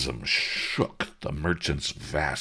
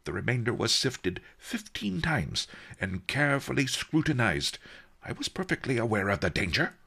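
high, offended self-justification; prickly old master with raised pitch; huffy; 9 semitones higher than usual. A narrator's reading. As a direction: defend the procedure huffily, hurrying at the end. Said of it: "Master Li said huffily".

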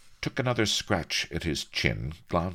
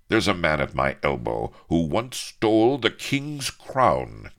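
loud, ringing boast; bold cry; 5 semitones higher, 6 dB louder than usual. A narrator's reading. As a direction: proclaim it to the crew. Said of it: "cried Avery".

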